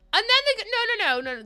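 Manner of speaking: high, loud, rapid-fire interruption; worked up. Real, not acted. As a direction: burst in, indignant. Indignant rant breaks in.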